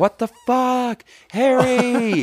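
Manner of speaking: high, slow mock British girl voice; mock British accent. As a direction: squeal it in a jokey British accent. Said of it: "you lean very forward when you do this accent".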